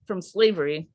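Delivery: measured, deliberate spoken-word reading; slow, earnest poem read with weighted repeats; poem recitation. Acted. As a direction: recite it to the room like a performance poem. Reads her poem to the roundtable.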